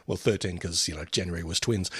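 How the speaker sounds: dropped low and soft, smug. Retold or imitated. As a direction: deliver it like a cocky star. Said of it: "He goes".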